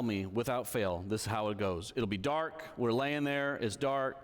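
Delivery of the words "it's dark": ordinary talk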